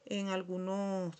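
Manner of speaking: ordinary talk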